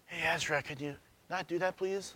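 soft, sugary, far too polite; mock-sweet hush, as if asking nicely; overly gentle request. Father mocking a too-gentle warning to his son, soft and sweet. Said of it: "I'm not gonna be like".

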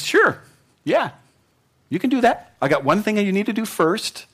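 high-pitched, easygoing mock reply; Jesus, breezily agreeing. Preacher voicing Jesus answering the rich young ruler, breezy and high. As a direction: agree readily in a lifted voice, then add a catch.

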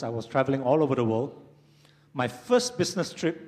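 normal talking voice

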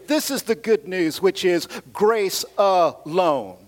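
normal talking voice

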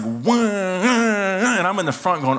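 long high drawn-out "waah"; high wail of an engine at full speed; imitated boat roar. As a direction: imitate the boat roaring over waves. Imitating boat motor in rough water.

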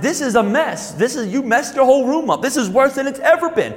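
high-pitched exasperated dad, retold; parent's rising voice. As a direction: act out a father's voice climbing as he scolds. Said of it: "so my voice is going up".

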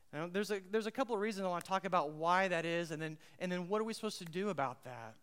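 ordinary delivery

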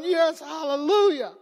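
high, loud cry of relief; hallelujah, the driveway is free; joyful exclaim. Retold or imitated. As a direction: shout for joy at the plow lady. Relief as plow clears berm.

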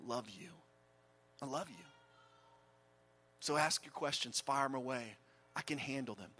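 soft, low, gentle reassurance; tender. Acted. Jesus softly reassures his doubting followers, quiet and tender. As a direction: murmur it like comforting a frightened friend.

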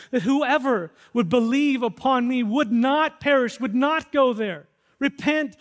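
loud, high, impassioned call to come; God's pleading cry. Acted. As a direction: preach it as God calling the lost home. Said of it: "That's God's cry".

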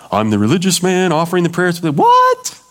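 a sharp, high yelp of disbelief on the final word; mock outrage, the pitch jumping way up; incredulous priest. Priest scoffing at an angel's impossible news, incredulous yelp.

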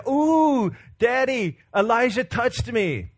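high, thrilled child imitation, drawn out at the end; excited little girl. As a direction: mimic a kid squealing news to Daddy. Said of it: "she got really excited about that".

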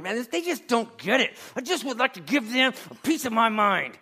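mock the frustrated believer who wants to tell them off; a huffy churchgoer ready to snap; fed-up grumbler. Imagined frustrated listener venting.

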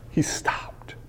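hushed whisper on one word. Shown as a demonstration. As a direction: drop to a whisper for awe. Dramatic hush on key words.

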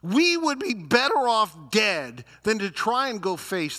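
loud, high mock complaint; hammed-up whining. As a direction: whine like the world's biggest complainer. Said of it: "these people are the biggest whiners".

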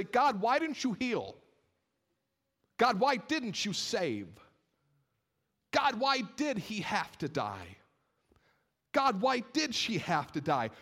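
high, slow, drawn-out anguished questioning; frustrated plea to God. Acted. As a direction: cry out to God, stretching each why. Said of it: "Some of you in frustration have looked at God".

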